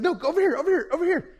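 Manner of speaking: high, urgent little monkey voice; insistent monkey. Acted. Monkey trying to steer the elephant, urgently insistent. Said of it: "The monkey's like".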